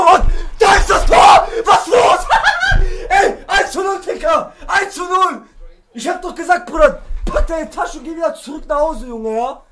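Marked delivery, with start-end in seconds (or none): 0.1-5.4 loud, high yelling with laughter; gloating goal celebration. Real, not acted. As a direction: crow over your goal at your rival.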